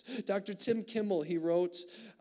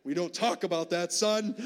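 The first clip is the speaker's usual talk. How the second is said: louder, mock-stern brush-off; mock stern dad; 6 dB louder than usual. Dad joking to the congregation about silencing his son, mock stern. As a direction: shut the topic down like a joking gruff father. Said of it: "It's not what I said, but that's what I wanted to".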